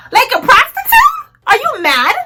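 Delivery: high, indignant incredulous retort. Retold or imitated. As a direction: pitch jumps up in outraged disbelief. Her retort to being left on corner.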